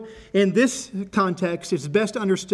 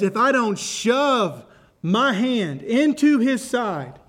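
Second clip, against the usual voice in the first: slow, shove into his side said with weight; stress on shove; 4 semitones higher, 3 dB louder, much slower than usual. Acted. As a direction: make shove sound forceful and deliberate. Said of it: "Put and shove".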